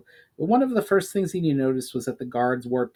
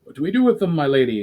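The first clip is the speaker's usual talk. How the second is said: higher, louder soldier voice; eager soldier; 3 semitones higher, 6 dB louder, faster than usual. Acted. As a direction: ask your lady for orders. Soldier asks his commander.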